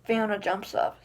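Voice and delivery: ordinary delivery, nothing marked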